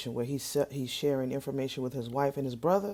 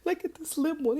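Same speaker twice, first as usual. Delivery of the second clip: much higher, giggly, slowed first line; amused squeal. Real, not acted. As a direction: point and crack up. Laughing at the slim one.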